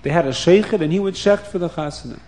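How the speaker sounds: usual speaking voice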